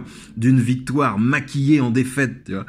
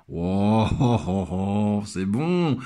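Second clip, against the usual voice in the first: relishing it; 5 semitones lower, much slower than usual. Real, not acted. Relishing the poet's clever move.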